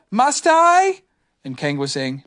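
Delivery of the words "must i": put-on high, louder reluctant child; a joey dreading medicine; whiny Roo. Roo begging Kanga, high and whiny. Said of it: "Roo was saying".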